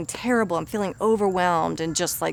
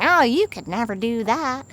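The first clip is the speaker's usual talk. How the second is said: silly higher cartoon voice; comical inner voice; 4 semitones higher than usual. Shown as a demonstration. Coach showing a silly cartoon inner critic, comic voice. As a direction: make the doubt sound ridiculous. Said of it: "some other comical voice that's saying".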